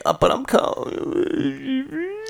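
high, slow whiny mimicry; mock a lame excuse, whining. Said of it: "He's pulling these excuses".

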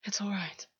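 soft, gentle reassurance; said softly. A narrator's reading. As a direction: soothe him gently. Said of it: "August said softly".

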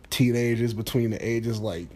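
normal talking voice

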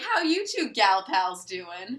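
slow, loud mocking bro; dopey bro voice. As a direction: play the clueless guy slowly. Mocking Peter's dopey greeting.

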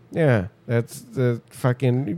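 ordinary talk

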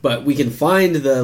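ordinary talk

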